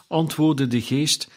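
ordinary delivery

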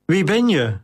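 unpitying repeat. A narrator's reading. Flavius questions the bleeding slave, mildly unpitying. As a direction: repeat it with mild insistence. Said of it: "vastbesloten om niet aan medelijden toe te geven".

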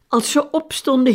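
usual speaking voice

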